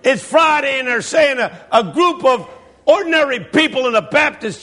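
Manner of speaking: high, driving sermon building to a shout; impassioned preacher. Retold or imitated. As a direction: imitate a preacher working up the crowd.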